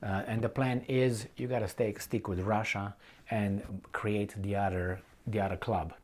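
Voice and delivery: usual speaking voice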